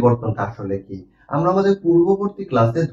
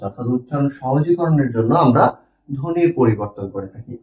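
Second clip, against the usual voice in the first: ordinary delivery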